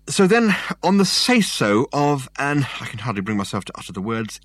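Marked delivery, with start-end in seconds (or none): 0.1-2.7 slow, higher, louder scorn; derisive. A narrator's reading. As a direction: drag out each word with contempt. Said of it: "in a voice ringing with derision".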